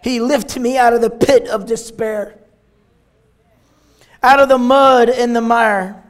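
loud, declaimed Bible verse; strong pulpit reading with pauses between phrases; preached scripture reading. Real, not acted. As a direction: proclaim the verse phrase by phrase with force.

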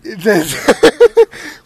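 high burst of laughter; loud laugh. Real, not acted. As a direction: crack up loudly.

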